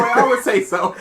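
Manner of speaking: laughing. Real, not acted. Laughing at the joke.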